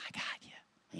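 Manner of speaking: hushed, secretive "I got you"; a whispered little promise; conspiratorial whisper. Retold or imitated.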